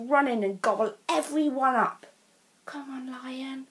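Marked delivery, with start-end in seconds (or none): 2.6-3.7 soft whispered wish; Molly silently willing the lion to come, whispered; whispered thought. A narrator's reading. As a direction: whisper it to yourself. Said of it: "thought Molly".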